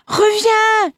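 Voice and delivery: high, loud frantic call; panicked cry. Acted. The mother crying to her son as he plunges into the river. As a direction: call him back in terror. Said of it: "cria la mère affolée".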